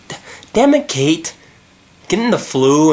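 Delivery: high, loud, hammed-up cry of annoyance; mock exasperated outburst. Real, not acted. Reader scolding the roommate Kate for getting sick, in mock exasperation. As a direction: blurt it out at Kate.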